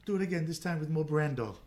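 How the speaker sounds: high and loud through laughter; breathless giggly request; laughing plea. Retold or imitated. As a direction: beg for an encore while still cracking up. Tour guide laughing.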